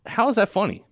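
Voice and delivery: high-pitched disbelief; asking in stunned disbelief; incredulous. Real, not acted. Incredulous at the Chavez joke.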